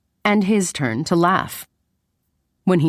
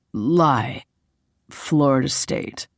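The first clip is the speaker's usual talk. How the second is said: slightly lowered, laid-back man's answer; lightly voiced male lead; 2 semitones lower, slower than usual. Man answering her college question in a game, lightly voiced.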